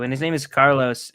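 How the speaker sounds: ordinary talk, nothing marked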